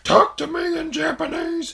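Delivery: high-pitched imitation of screaming; mock scream. YouTuber screaming at elderly people, high mock scream. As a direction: pitch it way up, like a crazed demand. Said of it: "screaming at some elderly people".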